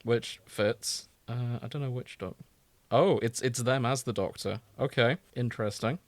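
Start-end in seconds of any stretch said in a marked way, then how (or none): none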